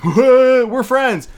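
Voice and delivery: high, loud, oblivious voice; clueless boy voice. Retold or imitated. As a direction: toss it off totally clueless. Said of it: "Just being".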